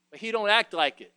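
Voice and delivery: ordinary talk, nothing marked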